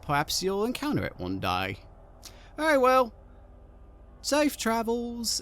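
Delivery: high hag calling after them; the hag calling after the departing group. Acted. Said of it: "She shouts after you".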